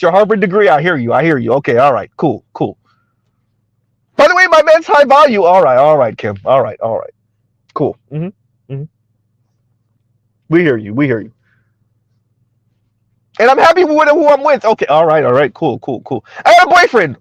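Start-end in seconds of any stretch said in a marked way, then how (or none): 4.2-5.4 high-pitched mock brag; mocking, high show-off voice; Kim Foster. Mocks Kim Foster's boasting. 13.4-14.6 high-pitched mock brag; Kim Foster. Mocks Kim Foster's boasting. 16.4-17.2 high-pitched mock brag; mocking, high show-off voice; Kim Foster. Mocks Kim Foster's boasting.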